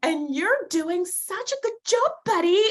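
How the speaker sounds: high, patronising praise; condescending compliment. Retold or imitated. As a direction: lay on sugary condescension.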